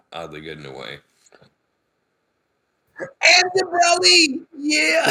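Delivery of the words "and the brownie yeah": high, loud, slow excited cry; excited shout. Real, not acted. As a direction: cry it out in delight.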